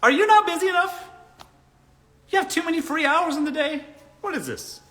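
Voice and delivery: high-pitched incredulous questioning; exasperated, hammed-up scolding; mock indignant reply. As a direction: challenge him, dropping on the last question. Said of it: "Ask him".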